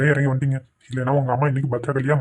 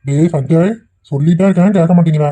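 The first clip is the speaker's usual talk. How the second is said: louder exasperated scold; 2 semitones higher, 6 dB louder than usual. Acted. Father scolding his son who won't listen, loud. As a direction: tell him off for not listening.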